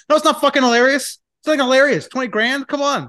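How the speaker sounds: high, loud, fed-up outburst; exasperated rant. Real, not acted. Man vents at the cost of his niece's dumps, high and exasperated. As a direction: let the frustration spill out. Angry rant about plumbing bill.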